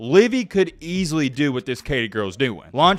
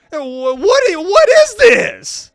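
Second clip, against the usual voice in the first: much higher, louder, dragged-out what is this; shocked outburst; 13 semitones higher, 9 dB louder, slower than usual. Real, not acted. A worse comment pushes him to loud shocked disbelief. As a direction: recoil at the words on screen.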